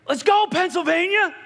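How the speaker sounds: high, loud imitated fan yell; cheering from the crowd. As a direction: whoop it out like a rowdy supporter.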